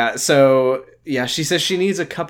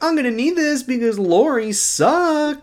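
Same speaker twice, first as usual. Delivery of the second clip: put-on whiny teen voice; 11 semitones higher than usual. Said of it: "he's like".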